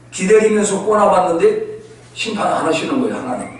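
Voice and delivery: usual speaking voice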